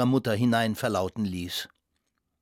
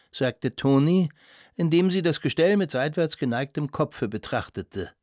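usual speaking voice

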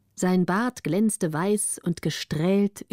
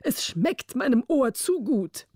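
delighted king; 4 semitones higher than usual. A narrator's reading.

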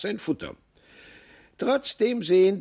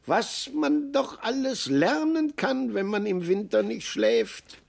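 marveling marmot; 9 semitones higher than usual. Acted. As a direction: sing out the amazement like a small creature. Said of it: "sagte Maxel".

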